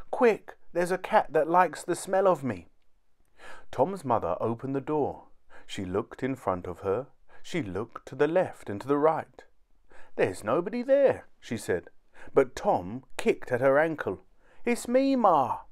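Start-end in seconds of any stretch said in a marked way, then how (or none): 0.1-2.7 urgent alarm. A narrator's reading. Tom urgently begs entry. 10.2-11.3 puzzled mother. A narrator's reading. Puzzled mother at empty door. 14.6-15.6 high-pitched little call; little boy calling. A narrator's reading. Tiny Tom calls up to mother.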